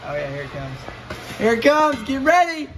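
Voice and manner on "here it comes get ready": high, loud urgent call; warning shout. Real, not acted. Transmission dropping, warning helper.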